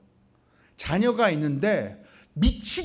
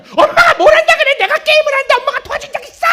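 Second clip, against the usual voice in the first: put-on shrill, high furious shout of a defiant kid; child screaming back; 12 dB louder, faster than usual. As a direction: scream at mom like an enraged teenager. Child yelling back at mother. (엄마에게 대드는 아이, 높고 크게 악쓰는 고함)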